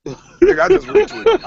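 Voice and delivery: a sudden excited squeal of a confession. Real, not acted. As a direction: yelp it out in real excitement. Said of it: "I got so goddamn excited".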